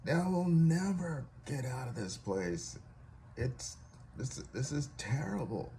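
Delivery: slow, high-pitched exasperation; acted despair at being stuck; retold freezing man's dismay. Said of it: "he was all cold and shivering and freezing".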